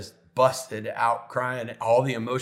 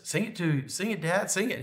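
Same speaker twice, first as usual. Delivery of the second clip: higher-pitched urging between sung lines; coaxing aside; 7 semitones higher, 4 dB quieter than usual. Retold or imitated. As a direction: nudge dad to join in, pitch lifted. Coaxing dad to sing along.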